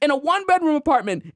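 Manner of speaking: Frank's rant. Retold or imitated. Retelling Frank's outburst at Homer, high furious shout. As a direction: explode in envious fury. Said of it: "Frank's like".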